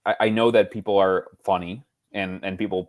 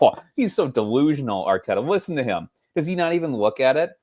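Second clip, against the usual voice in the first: mocking critics; 7 semitones higher than usual.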